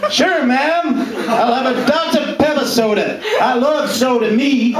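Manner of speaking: high, hammed-up American drawl-free twang; mock American accent. Stand-up comic mocking Americans ordering soda, high American accent. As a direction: imitate a gushing American soda fan, way up high. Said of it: "that's how we talk".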